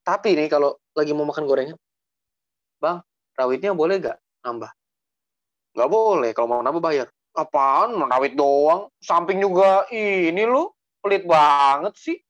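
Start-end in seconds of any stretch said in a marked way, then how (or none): 2.8-4.7 plain-voiced, firmer asking; pushy request. Shown as a demonstration. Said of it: "yang begitunya itu maksudnya". 5.7-12.2 loud mock indignation; hammed-up stall argument; rude, pushy bickering. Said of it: "yang begitunya itu maksudnya".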